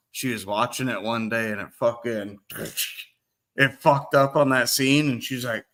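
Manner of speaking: normal talking voice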